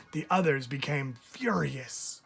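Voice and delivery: usual speaking voice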